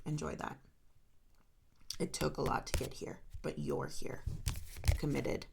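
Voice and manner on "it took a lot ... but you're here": whispered, barely voiced repeat; soft whisper. Real, not acted. Reader whispers encouragement to Scorpio, hushed. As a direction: breathe it to the listener.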